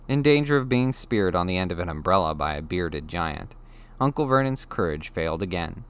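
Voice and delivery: ordinary talk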